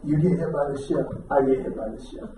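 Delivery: low, soft heartfelt apology; tender sincerity. Retold or imitated. Husband apologising to his wife that night, low tender sincerity.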